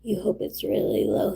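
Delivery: ordinary talk, nothing marked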